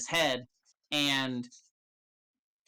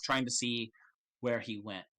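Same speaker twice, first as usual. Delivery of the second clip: ordinary talk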